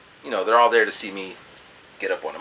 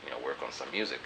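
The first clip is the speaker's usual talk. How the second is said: much softer and lower; shy thanks; 7 semitones lower, 12 dB quieter, faster than usual. Retold or imitated. Handing the girl his CD, shy and soft. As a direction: mumble it bashfully. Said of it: "I'm like".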